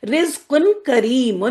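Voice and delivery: رزق کریم slowly, tanween clear; slow recitation. Shown as a demonstration. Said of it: "ٹھیک ہے". (آہستہ تلاوت)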